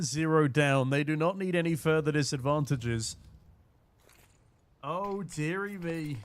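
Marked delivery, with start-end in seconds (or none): 4.8-6.2 soft, slow, quiet dismay; a soft, slow sigh of dismay; hushed dismay. Real, not acted. Caster groans at a teammate knifing, softly and slowly. Caster reacts to team-kill.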